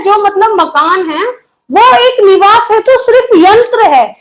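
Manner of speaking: normal talking voice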